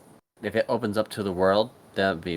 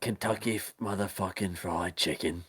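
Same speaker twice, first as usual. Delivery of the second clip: mock Butcher line; 2 semitones lower, 9 dB quieter than usual.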